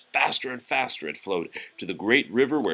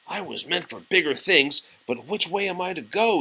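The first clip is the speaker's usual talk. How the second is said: a touch of a higher, grumpy tugboat; lightly voiced cross little boat; 7 semitones higher, 4 dB quieter than usual. Tugboat cross in the morning, a hint of grumbling. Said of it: "he said".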